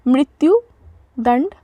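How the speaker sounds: मृत्युदंड said slowly, syllable by syllable; slow careful repetition. Shown as a demonstration. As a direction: stretch out मृत्युदंड for learners. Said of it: "मृत्युदंड repeated slowly". (मृत्युदंड को धीरे-धीरे, साफ़ बोलें)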